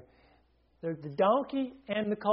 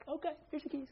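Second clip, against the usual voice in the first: meek compliance; 10 semitones higher, 9 dB quieter, faster than usual. Retold or imitated. Owner voiced giving keys.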